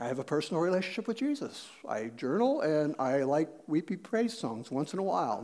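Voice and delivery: mock-modest, understated answer; meek churchgoer. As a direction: list it quietly, as a feeble offering. Churchgoer's weak answer to Peter.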